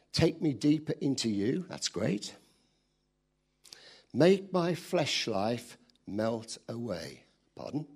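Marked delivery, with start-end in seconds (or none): 4.1-7.2 slow, drawn-out prayer line; lingering over Make; slow prayer quote. Retold or imitated. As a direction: read it slowly like a pleading prayer. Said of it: "that is a prayer".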